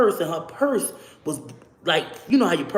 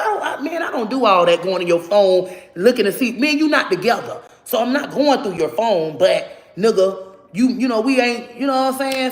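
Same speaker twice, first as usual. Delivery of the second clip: loud, raised, heated telling-off; retold loud argument; 5 semitones higher, 6 dB louder than usual. To the boyfriend in the car, loud angry retort. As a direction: set him straight, loud and high.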